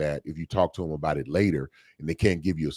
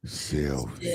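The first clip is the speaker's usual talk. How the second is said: low sexy voice; 5 semitones lower, 7 dB quieter than usual. Pretend. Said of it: "He gotta hit the voice".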